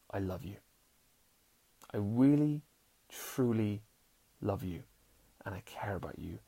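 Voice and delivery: soft tender words that trail into a whisper. Pretend. Showing a partner you truly care: soft tender, ending in a whisper. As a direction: murmur it like a confession of love. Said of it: "what that says to them is".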